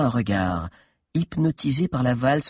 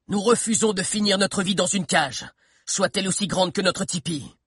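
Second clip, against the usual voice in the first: higher accusing voice; raised voice; 6 semitones higher than usual. Acted. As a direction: accuse them boldly up at the balcony. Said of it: "haussa la voix".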